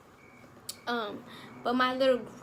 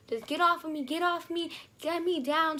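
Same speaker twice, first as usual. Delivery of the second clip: retold scream; 6 semitones higher, 4 dB louder than usual.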